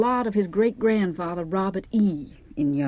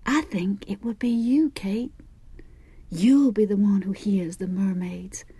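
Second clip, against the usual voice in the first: unvoiced, knowing whisper; hushed confiding voice; mysterious whisper; 2 semitones higher than usual. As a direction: whisper it like a secret prophecy. Fig whispering a prophecy to Kate.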